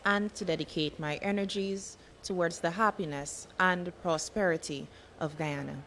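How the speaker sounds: soft, measured formal recital; pledge recitation. Acted. Leading an assembly in the National Pledge, soft formal recitation. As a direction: recite it solemnly in short, paused phrases.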